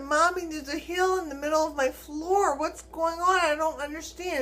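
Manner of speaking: lightly acted bewildered call for help; retold panic. Daughter phones mom about hill in floor, mildly panicked.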